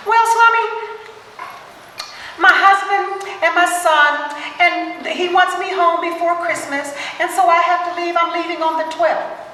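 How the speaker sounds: high nasally voice. Retold or imitated. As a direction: mimic her high, nasal pitch all the way through. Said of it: "she has this high, nasally pitched voice".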